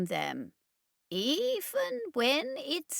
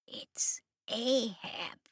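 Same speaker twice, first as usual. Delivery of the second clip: soft, slow whisper; hushed voice; 3 semitones higher, 5 dB quieter, much slower than usual. Acted. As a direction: whisper so the beings can't hear. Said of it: "he went on in a hushed voice".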